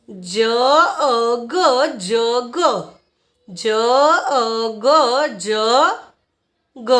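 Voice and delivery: જ, અ, ગ drawn out singly, then joined as જગ; sounding out. Shown as a demonstration. Teacher spelling jug by sound for learners, sounding out. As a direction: stretch each sound of જ, અ, ગ before blending. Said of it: "જેનું ઉચ્ચારણ થશે જ".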